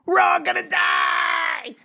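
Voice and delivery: drawn-out high-pitched shout; hammed-up high wail of alarm; mock panicked scream. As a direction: yell it like comic doom has struck. Mock panic after saying very calmly.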